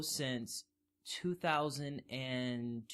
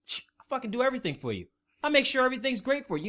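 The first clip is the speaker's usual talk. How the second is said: higher, defensive dad voice; absent dad; 8 semitones higher, 5 dB louder, faster than usual. Acted. Absent dad finally turning up to his kid, indignant. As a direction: protest like a put-upon absent dad. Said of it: "it's like that dad that's not there".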